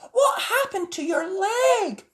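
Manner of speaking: high, loud, slow worried question; Sally alarmed at Spot's leg; Sally's worried voice. A narrator's reading. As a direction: ask high with concern.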